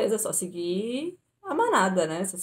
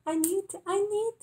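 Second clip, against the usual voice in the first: high, pleading, panicked voice; desperate plea; 10 semitones higher, slower than usual. Retold or imitated. Traveler begging the gate agent to let her board, high panicked plea. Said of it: "eu mostrei pra ela".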